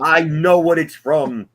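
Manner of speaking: high, slow, loud retort; loud protest. Real, not acted. Protesting at being corrected.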